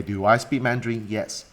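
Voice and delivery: ordinary talk, nothing marked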